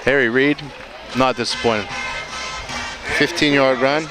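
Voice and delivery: ordinary delivery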